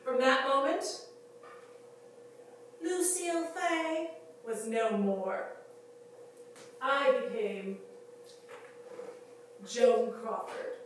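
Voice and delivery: loud, slow, theatrical proclamation; ringing, deliberate star's pronouncement; grand declaration. Acted. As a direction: announce the new self with weighty pauses. Grand declaration of her new name.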